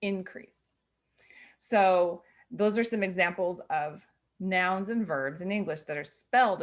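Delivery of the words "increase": increase stressed on the first syllable; INcrease, the noun form. Shown as a demonstration. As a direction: lean on IN in increase. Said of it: "is the noun".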